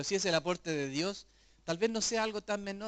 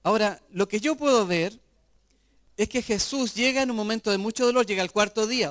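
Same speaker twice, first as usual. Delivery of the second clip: louder, driving preacher's voice; fervent preaching; 3 semitones higher, 7 dB louder than usual. Acted. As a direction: proclaim it to the congregation. Preaching builds to loud emphasis.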